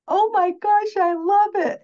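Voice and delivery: high, loud, thrilled gasp; delighted brother. Retold or imitated. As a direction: squeal with sudden joy at the drawing. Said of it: "he went".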